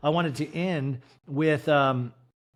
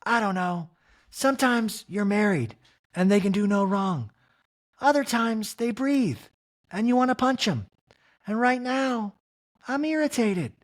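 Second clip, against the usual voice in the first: mock high irritated wife; 8 semitones higher than usual. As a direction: drag out her irritation, pitched up. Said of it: "Kyle in a talking head goes".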